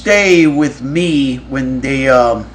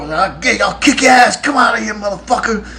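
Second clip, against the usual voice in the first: high-pitched raging yell, imitated; having a fit; 8 semitones higher than usual. Kirk outside the locked van door, imitated furious fit. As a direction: rage like you're locked out. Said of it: "Kirk was out there having a fit".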